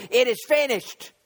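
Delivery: high, ringing pulpit cry imitating Jesus; preacher's loud cry. Preacher voices Jesus on the cross, crying out loudly. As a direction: cry it out for the congregation. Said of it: "cried out with a loud voice".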